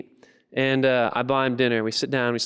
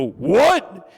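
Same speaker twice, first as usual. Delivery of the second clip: loud, higher one-word challenge; challenging yell; 7 semitones higher, 6 dB louder than usual. Acted. Big guys outside the car question George, loud challenging yell. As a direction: fire it back in disbelief.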